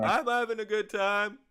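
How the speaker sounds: squeaky loud fan reply; high crowd voice. Acted. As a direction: shout back as a fan. Crowd member shouts back.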